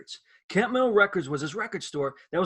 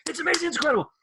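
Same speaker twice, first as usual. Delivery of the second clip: excited; 10 semitones higher, 4 dB louder, much faster than usual. Retold or imitated. Awestruck fan at the counter.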